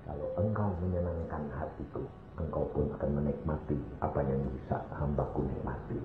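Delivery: hushed, low divine promise; voice of God. Retold or imitated. God promising reward to those who please Him, soft and low. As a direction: speak as God, very softly and low. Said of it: "Tuhan ngomong apa?".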